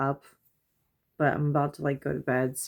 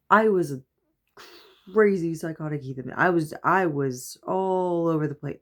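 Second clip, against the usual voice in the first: ordinary talk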